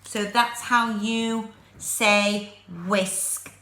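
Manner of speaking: usual speaking voice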